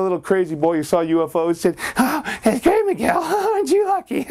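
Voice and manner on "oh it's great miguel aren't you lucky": put-on high eager child; excited kid. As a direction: gush about the gift. Other boy gloats.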